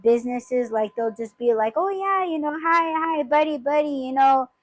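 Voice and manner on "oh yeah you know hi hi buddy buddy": mock sugary greeting, pitched much higher; fake friendly. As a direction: ham up a fake, fawning hello. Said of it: "ass kissing".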